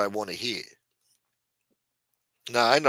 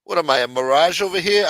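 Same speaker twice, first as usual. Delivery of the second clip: mock indignation; 5 semitones higher, 7 dB louder than usual. Acting out his line to cashier.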